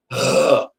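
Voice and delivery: acted groan of frustration; exasperated groan. Owner fed up with the dog's behaviour, exasperated groan. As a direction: let out a fed-up "ugh".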